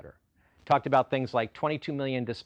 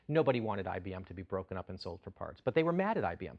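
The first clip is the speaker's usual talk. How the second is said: ordinary talk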